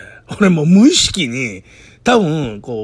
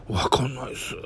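whisper; 9 dB quieter than usual. Retold or imitated. Colleague whispers back.

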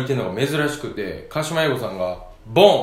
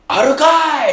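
a sudden high, loud Osaka-style retort; 11 semitones higher, 7 dB louder than usual. Retold or imitated. Old singer loudly retorting to the boy's answer, loud and sharp. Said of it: "めっちゃ大きい声で".

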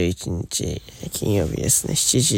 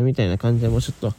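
ordinary delivery

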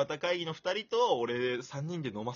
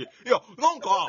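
louder, higher, laughing burst of enthusiasm; high-tension imitation; 8 semitones higher, 12 dB louder than usual. Teasing his friend's excitement about Kohei, high-tension imitation. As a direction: mimic a friend getting all worked up. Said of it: "テンションの差に俺はへこん".

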